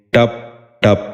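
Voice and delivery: ٹپ ٹپ with short a, "tap tap"; short a in each ٹپ; correct pronunciation. Shown as a demonstration. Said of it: "دوبارہ سنیے".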